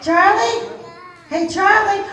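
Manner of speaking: loud high call across the room. Real, not acted. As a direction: call out to Charlie in the back. Calling out for Charlie.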